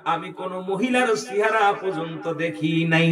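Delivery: ordinary delivery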